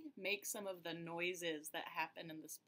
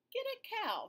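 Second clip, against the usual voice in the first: much higher, brisk instruction; wise man advising; faster than usual. Acted. Wise man telling Peter what to do, bright. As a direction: hand down the advice brightly. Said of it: "said the wise man".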